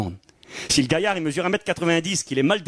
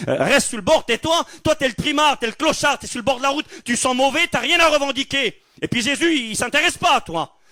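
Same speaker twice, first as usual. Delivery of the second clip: contemptuous scolding; 9 semitones higher than usual. Retold or imitated. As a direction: put the beggar in his place. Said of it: "on pouvait lui dire".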